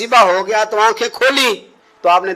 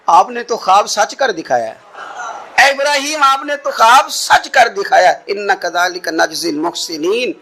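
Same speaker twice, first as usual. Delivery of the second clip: ordinary talk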